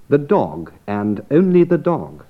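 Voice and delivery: "only" hit hardest, "dog" firm both times; emphatic contrast on "dog"; stress on dog and only. Shown as a demonstration. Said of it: "and not the cat or the cook".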